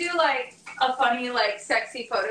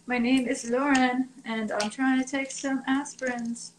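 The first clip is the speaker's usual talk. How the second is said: normal talking voice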